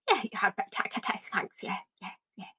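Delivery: soft unvoiced whisper; whispered aside. She whispers her reply to Kate about the covid test. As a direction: mutter it under your breath sarcastically.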